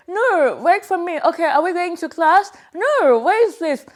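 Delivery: high, loud replay of the tongue-to-the-side voice; imitating tongue-to-side talk. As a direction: act out how you sounded at school talking with your tongue to the side. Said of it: "I started speaking like that".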